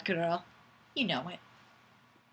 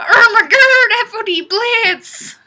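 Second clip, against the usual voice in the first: gasp, then much higher, louder hammed-up cry; mock shocked gasp; 11 semitones higher, 8 dB louder than usual. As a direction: act floored by the surprise.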